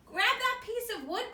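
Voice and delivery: high, loud call across the river. Acted. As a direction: call out urgently to your friend. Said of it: "called Scamp".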